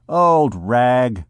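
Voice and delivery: ordinary delivery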